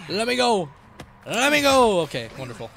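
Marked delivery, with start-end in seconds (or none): 0.1-0.7 louder, higher demand to be let go; insistent call. Real, not acted. Player to the game, let me go, raised insistent call. 1.3-2.0 high, loud drawn-out holler; escalated yell. Real, not acted. Player stuck in the game, demanding release, high loud yell.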